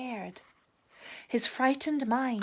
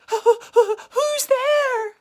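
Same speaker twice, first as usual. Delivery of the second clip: high, loud scared question; frightened cry; 14 semitones higher, 11 dB louder than usual. A narrator's reading. Rabbit in the mist, high frightened shout. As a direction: cry out in fear.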